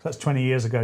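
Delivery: usual speaking voice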